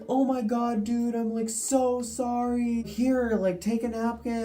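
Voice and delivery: high flustered apology; spilling guy's frantic apology; apologetic guy. Retold or imitated. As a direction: mimic a dude gushing sorry. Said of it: "And he's like".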